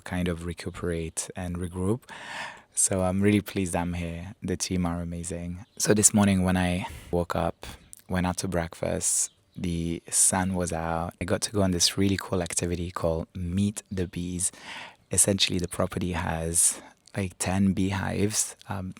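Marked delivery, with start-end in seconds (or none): none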